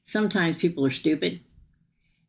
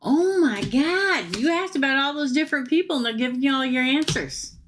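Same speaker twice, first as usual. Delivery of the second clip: amazed excitement; 9 semitones higher than usual. Real, not acted. Amazed by matching tarot cards.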